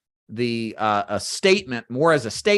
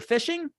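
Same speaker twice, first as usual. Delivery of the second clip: "fishing" pitched sharply up; rising fishing; 14 semitones higher, 4 dB quieter than usual. Shown as a demonstration. Coach showing the questioning rise on one word, high rise. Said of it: "I'm asking a question with how I'm saying that word".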